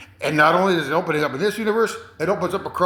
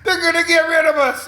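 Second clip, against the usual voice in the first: much higher, urgent mock panic; alarmed high voice; 13 semitones higher, 4 dB louder than usual. Man warning others about aliens, in a high mock-panicked voice. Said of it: "He goes".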